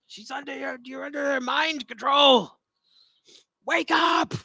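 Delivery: high, loud cry building to a shout; urgent in-character shout. Acted. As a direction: call out urgently to wake them.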